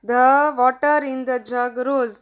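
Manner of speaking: very slow, word-by-word English reading. Shown as a demonstration. Teacher reads the worksheet sentence to children, very slow and deliberate. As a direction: spell it out slowly for the kids.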